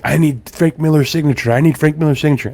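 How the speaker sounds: high, insistent mock pleading; imitated freak-out. Customer fixated on getting a signature, mock freak-out. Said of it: "this guy is still freaking out so bad".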